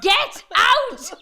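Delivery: high-pitched yell cracking with laughter; mock outraged mum yell, half laughing; retold yell. Mum ordering child out of the buggy, mock yell.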